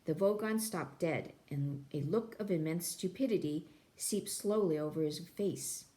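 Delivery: ordinary delivery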